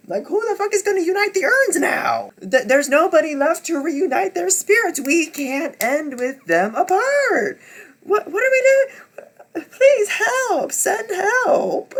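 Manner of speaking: high, loud, half-laughing panic; laugh-crying wail; frantic panic. Real, not acted. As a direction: plead for rescue in distress. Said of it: "I was freaking out".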